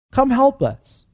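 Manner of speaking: high-pitched appeal for help; pleading call. Retold or imitated. Preacher voicing people who need the church, pleading.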